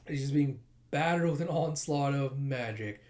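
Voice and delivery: ordinary talk, nothing marked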